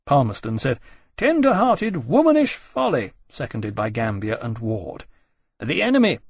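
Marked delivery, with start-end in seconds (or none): 1.2-3.1 high, loud contempt; scornful dismissal. Acted. Palmerston dismissing Lawrence's plea, scornful. 5.6-6.2 higher, louder, pressing on; raised over noise. Acted. Nelson trying to reply over the noise, raised voice. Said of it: "said over the noise".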